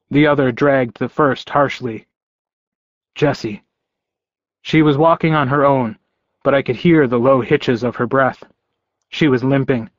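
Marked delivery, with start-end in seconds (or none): none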